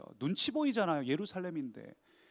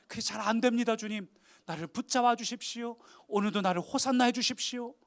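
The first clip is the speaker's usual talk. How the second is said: high, raised, fervent prayer; impassioned confession from the pulpit; pleading prayer; 6 semitones higher, 5 dB louder than usual. Acted. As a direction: plead earnestly with the Lord. Preacher voices a pleading prayer.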